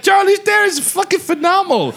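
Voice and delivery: high-pitched enthusiastic shout; emphatic praise. Retold or imitated. Comedian rebutting his friend's jab, emphatic shouted praise.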